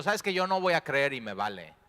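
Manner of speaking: sulky defiance, voiced loud; defiant refusal. Thomas refusing to believe, loud and defiant. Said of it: "En su decepción decidió".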